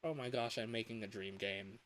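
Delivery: ordinary talk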